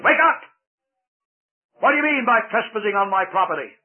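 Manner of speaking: roaring giant, high and loud; angry giant shouting. A narrator's reading. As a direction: bellow at the trespassers. Giant yells at sleeping pilgrims.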